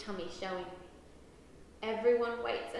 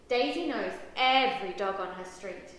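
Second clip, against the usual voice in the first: ordinary delivery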